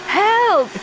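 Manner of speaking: high, loud shriek; a piercing, loud cry. A narrator's reading. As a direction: shriek for help. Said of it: "shrieked Margaret".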